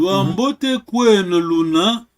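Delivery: loud, slow question with rising end; slow practice question. Shown as a demonstration. Teacher asks student on behalf of the crowd, slow practice question. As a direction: put the question slowly and loudly.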